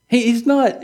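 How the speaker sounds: ordinary talk, nothing marked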